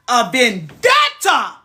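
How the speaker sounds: loud, very slow declaration; declaring a vendetta against a chef; slow dramatic shout. Real, not acted. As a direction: announce it like a war cry.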